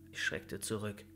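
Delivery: plain reading voice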